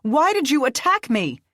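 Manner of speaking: high, loud angry shout; furious yelled demand. Acted. Becca shouting mid fight.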